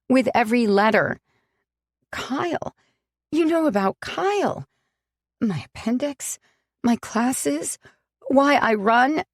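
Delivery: high and strained, voice pushed up; hurt accusation. Acted. Sam reproaching Alex for knowing her secrets, high and strained. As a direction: fling each item back at him. Sam lists what he knows.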